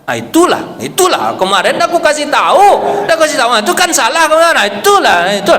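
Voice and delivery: hammed-up I-told-you-so, half laughing; what Umar should not have said, as a mock gloating scold. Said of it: "Harusnya kan Umar bin Khattab bilang apa".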